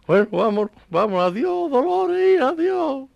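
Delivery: high, loud goodbye to his dead wife; widower's farewell. Retold or imitated. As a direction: call adiós into the dark. Viudo se despide de la muerta. (viudo despidiéndose a voces de Dolores)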